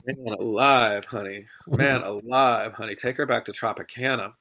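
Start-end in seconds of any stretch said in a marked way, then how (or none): 0.1-2.9 drawn-out exasperation. Real, not acted. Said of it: "drawn-out exclamation".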